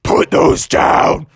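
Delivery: slow, rough monster shout; roared dragon command. Acted. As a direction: order him as a furious dragon. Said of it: "the dragon said".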